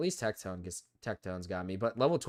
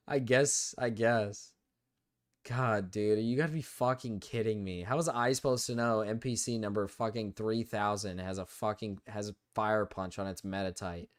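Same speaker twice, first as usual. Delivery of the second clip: ordinary talk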